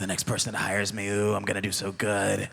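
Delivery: low, scheming whisper; spiteful whisper. Retold or imitated. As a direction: whisper it like a gleeful threat.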